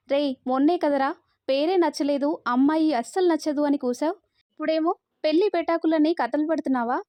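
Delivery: ordinary delivery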